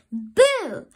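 high, loud sudden boo; shouted boo. A narrator's reading. Sister startling her brother, loud shouted boo. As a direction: jump out and yell it. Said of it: "sneaked up behind him and shouted".

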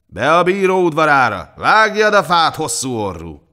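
guard driving a labourer with shouts; bellowed orders. A narrator's reading. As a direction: bellow orders across a yard, easing on the last words. Guard shouts orders at disguised king.

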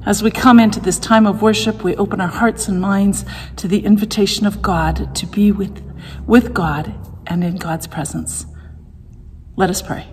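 ordinary delivery

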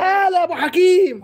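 high laughing hello, slowed; laughing greeting. Real, not acted. Greeting caller Abu Hakim live, laughing high. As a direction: laugh the welcome out, stretched and high.

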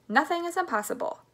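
high, buoyant assurance; confident cry. A narrator's reading. Mister Wonka rallies Grandpa Joe, confident high cry. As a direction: lift it high with total confidence. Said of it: "cried Mister Wonka".

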